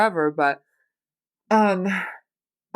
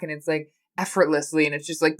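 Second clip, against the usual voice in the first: ordinary talk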